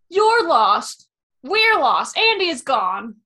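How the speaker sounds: loud Woody-style frustrated outburst; Woody impression. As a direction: shout it in angry frustration. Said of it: "The intonation, loved it".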